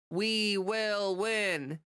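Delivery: very slow, drawn-out mock shout with no emotion; Schumer's flat shout. Schumer rallying the crowd, slow, emotionless mock shout. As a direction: drag each word out, no feeling behind it. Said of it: "this is literally the tone of voice that he's shouting this in".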